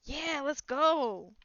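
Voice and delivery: very slow, stretched-out reply; drawn-out. Acted. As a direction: drag out every word.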